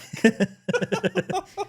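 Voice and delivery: squealing burst of laughter; laughing high. Real, not acted. Laughs at own joke.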